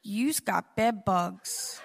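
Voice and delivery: low, slow, smooth tough-guy voice; a suave deep-set drawl of bad news; exterminator's voice. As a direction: play it cool and suave, slowly. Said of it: "And he said".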